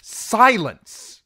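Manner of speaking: queen silencing her seneschal in fury; furious command. Acted. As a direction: cut him off with fury. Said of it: "flinching from the fury in her tone".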